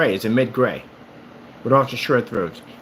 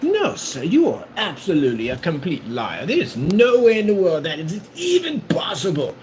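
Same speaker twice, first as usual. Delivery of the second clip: hammed-up gentleman's challenge, pitched high with a hushed middle; mock old-fashioned gentleman demanding satisfaction; old-time duelist; 5 semitones higher than usual. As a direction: play a pompous duelist calling out a liar. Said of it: "I saw that nonsense like".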